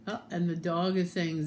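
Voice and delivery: ordinary delivery, nothing marked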